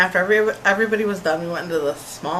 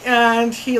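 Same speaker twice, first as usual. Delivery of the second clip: ordinary talk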